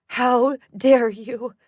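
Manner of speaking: slow, loud outrage rising on dare; furious mother. Acted. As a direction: spit out each word in rage.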